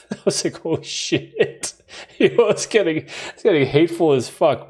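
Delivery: laughter breaking into the words; laughing. Real, not acted. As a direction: crack up while reacting in disbelief. Laughing over friends' hateful nickname.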